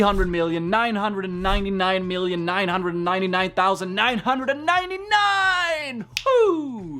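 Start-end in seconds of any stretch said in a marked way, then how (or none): 2.4-7.0 high, stretched-out crowing finish; excited climax. Shown as a demonstration. Teacher reaching the biggest number yet, high excited whoop. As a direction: climb high and hold the last word, then whoop.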